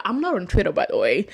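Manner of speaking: usual speaking voice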